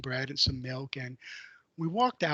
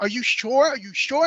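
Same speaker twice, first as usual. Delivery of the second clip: incredulous double-check; 8 semitones higher, 8 dB louder than usual. Retold or imitated. Doubting manager's cash offer.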